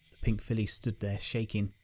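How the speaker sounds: usual speaking voice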